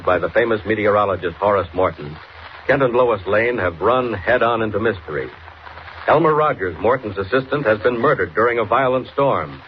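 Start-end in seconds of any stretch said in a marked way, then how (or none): none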